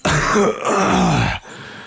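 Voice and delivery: rough hurting cough; wounded soldier coughing before answering; pained cough. Acted. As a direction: cough through the injury. Wounded soldier after fight.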